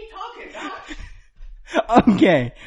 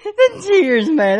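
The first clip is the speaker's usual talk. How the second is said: high, cracking laughter; laughing so hard it cries; 13 semitones higher, 8 dB louder, much slower than usual. Real, not acted. Laughing at friend's tears.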